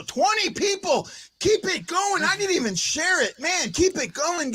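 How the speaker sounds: high-pitched excited hype; delighted, high and bouncy; thrilled. Real, not acted. Thrilled at the viewer count.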